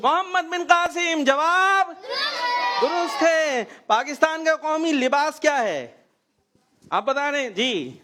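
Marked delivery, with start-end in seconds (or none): 0.0-5.9 high, ringing call of the verdict; quiz show announcer. Real, not acted. Host confirming a child's answer, announcer style. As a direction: announce it big to the audience.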